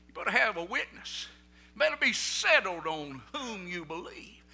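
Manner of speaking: usual speaking voice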